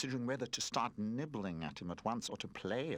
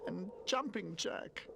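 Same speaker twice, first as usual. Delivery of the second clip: homesick wail; 13 semitones higher than usual. A narrator's reading. Homesick toy crying alone.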